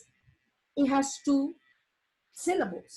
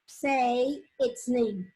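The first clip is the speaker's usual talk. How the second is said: slow, deliberate teacher emphasis; much slower than usual. Shown as a demonstration. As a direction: say its name, drawn out slowly. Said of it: "the silent E makes the I say".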